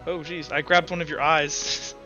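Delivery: ordinary talk, nothing marked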